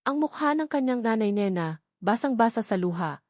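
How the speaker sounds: ordinary delivery, nothing marked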